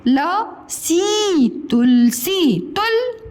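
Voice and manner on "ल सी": each syllable stretched long; very slow syllables. Shown as a demonstration. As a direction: sound it out for the class.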